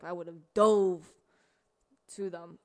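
sudden loud, slow burst of anger; fierce outburst. Retold or imitated. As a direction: hit the words slowly, like a threat. Ready to fight someone back.

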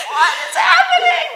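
high, loud exclamation of disbelief; flabbergasted outcry. Retold or imitated. Driver amazed at staff singing along, flabbergasted shout. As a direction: shout it in comic bewilderment. Astonished by everyone joining in.

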